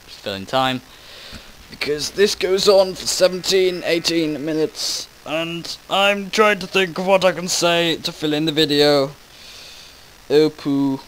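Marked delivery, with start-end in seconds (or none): 1.7-11.0 higher excited. Real, not acted.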